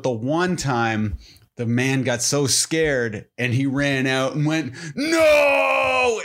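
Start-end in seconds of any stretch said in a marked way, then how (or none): 4.9-6.2 a sudden high, loud movie-style scream. Retold or imitated. Scared man running out of the store, high loud movie scream. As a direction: belt it out like a terrified movie victim. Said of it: "exactly like a movie and screamed".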